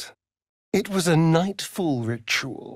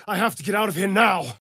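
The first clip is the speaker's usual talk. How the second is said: urgent shout; 5 semitones higher, faster than usual. Acted.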